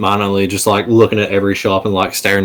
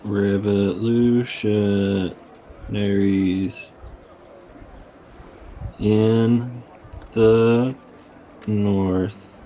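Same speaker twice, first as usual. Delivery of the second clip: very slow, halting, one word at a time; haltingly piecing the phrase together, drawn out; slow sounding out; 6 dB quieter, much slower than usual. Acted. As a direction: leave long pauses between the words. Character slowly sounding out what was heard.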